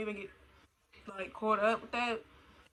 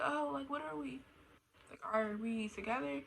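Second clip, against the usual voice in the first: tentative mock question; 5 semitones higher, 5 dB quieter than usual. Acts out girl asking guy.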